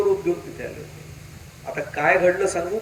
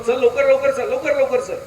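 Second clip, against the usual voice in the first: higher, louder repeated urging; urgent hurrying; 6 semitones higher, 6 dB louder than usual. Retold or imitated. Boss ordering his driver onward, acted urgent hurrying. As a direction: hurry the driver along insistently.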